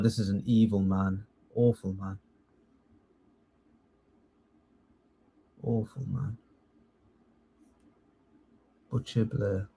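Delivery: real quiet scorn; low disgust. Real, not acted. Condemning Tony Blair.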